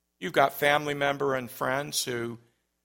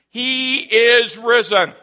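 higher, louder, slow proclamation; louder call; 8 semitones higher, 13 dB louder, slower than usual. Real, not acted. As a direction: make people outside hear it. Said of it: "I want them to hear it".

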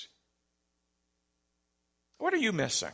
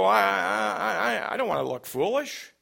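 hesitant mock worry; 5 semitones higher than usual. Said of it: "You might go".

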